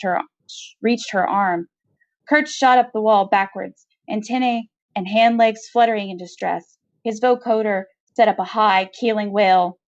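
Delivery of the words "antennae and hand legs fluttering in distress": ordinary delivery, nothing marked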